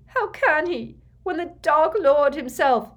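high, loud anguished protest; sobbing. A narrator's reading. Mother despairing of her son's task, sobbing loudly. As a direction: cry it out in despair. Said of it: "sobbed Narcissa".

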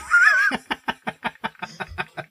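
squeaky high laugh; high giggle. Real, not acted. Laughs after being shushed.

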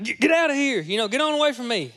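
high, loud panicked shooing; alarmed recoil. Retold or imitated. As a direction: jump back and yell it away. Recoils from a demon in dream.

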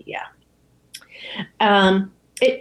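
ordinary talk

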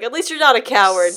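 loud, pleased bark of a hard father; gruff approval. Retold or imitated. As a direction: boom it out, grudgingly impressed. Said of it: "He's like".

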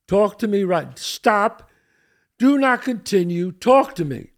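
loud, slow barked commands to stop; yelled back. Retold or imitated. Homeowner yelling at a stranger on the driveway, loud commands. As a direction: shout him to a halt from the doorway. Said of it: "And I yelled back at him".

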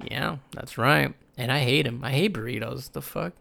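ordinary talk, nothing marked

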